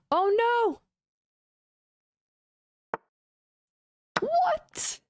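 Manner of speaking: very high, stretched shriek of disbelief; the words leap up in sudden alarm; shocked high cry. Real, not acted. Chess player seeing a disastrous move, shrieking in shock.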